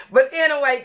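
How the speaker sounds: ordinary talk, nothing marked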